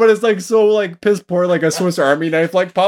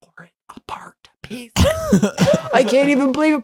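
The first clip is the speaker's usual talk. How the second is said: ordinary talk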